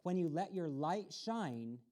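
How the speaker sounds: plain reading voice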